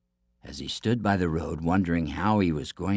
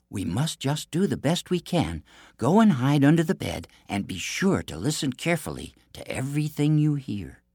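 higher-pitched, lightly voiced princess; princess character voice; 7 semitones higher than usual. A narrator's reading. Princess tells the prince where to hide, lightly voiced.

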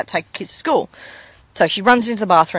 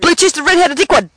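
higher, rushed through a laugh; fast tongue-twister; 6 semitones higher, 4 dB louder than usual. Shown as a demonstration. Taking co-host's challenge to say it fast, rushed. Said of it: "say it as fast as you can".

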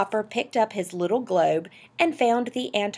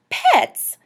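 loud, high outburst; indignant exclamation; 13 semitones higher, 11 dB louder than usual. Acted. Wife objecting to having a pet, indignant. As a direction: burst out with one scornful word.

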